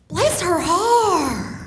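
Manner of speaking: high, slow and syrupy "Bless her heart", stretching "Bless"; sweet sincere bless her heart. Shown as a demonstration. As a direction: pour on the sugary Southern sympathy. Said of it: "the first way is".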